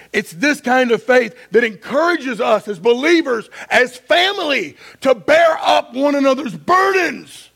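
preaching. Real, not acted. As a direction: drive it home from the pulpit. Preaches at full force.

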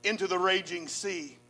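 measured, dramatic Bible reading; preacher reading scripture with rising drama. Acted. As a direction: read the story to the congregation. Reads Jonah's story aloud.

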